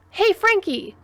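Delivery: high, loud hail; called out. A narrator's reading. Human calls coworker over for opinion, calling out. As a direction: call across the room to a coworker. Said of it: "human friend Mac called out".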